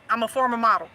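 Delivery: ordinary talk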